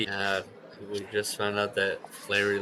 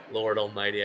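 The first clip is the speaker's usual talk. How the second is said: usual speaking voice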